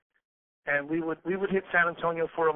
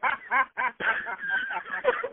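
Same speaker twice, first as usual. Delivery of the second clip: laugh. Real, not acted. Laughing at the memory.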